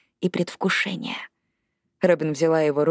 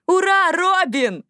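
high, drawn-out cheer; stretched, jubilant call; cheering cry; 11 semitones higher, 3 dB louder, slower than usual. A narrator's reading. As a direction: cheer from the stands, voice pitched way up. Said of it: "раздался крик".

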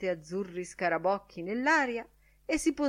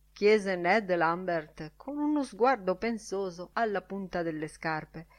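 ordinary delivery

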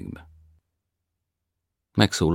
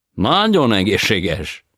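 foreign tenor voice; 7 semitones higher, 12 dB louder, much slower than usual. A narrator's reading. Tenor answers.

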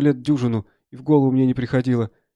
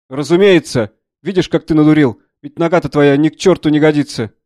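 loud, raised scolding; overbearing doctor; 3 semitones higher, 9 dB louder than usual. Acted. Doctor insisting to a soldier on amputation, loud and scolding. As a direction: override the patient forcefully.

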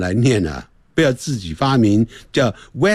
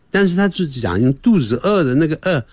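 normal talking voice

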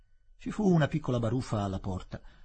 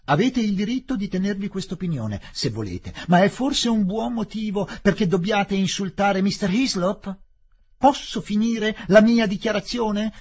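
loud, high-pitched official scolding; angry rebuke; 10 semitones higher, 9 dB louder than usual. Acted. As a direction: bark the rebuke, climbing louder. Collector rebukes Fielding angrily.

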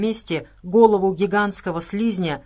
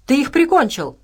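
loud, high-pitched outburst; angry raised-voice cry; roared accusation; 7 semitones higher, 6 dB louder than usual. A narrator's reading. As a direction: hurl it as an accusation. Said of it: "взревел медвежонок".

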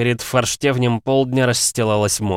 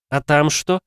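higher, lighter woman's voice; female character voice; 8 semitones higher, 4 dB louder than usual. Acted.

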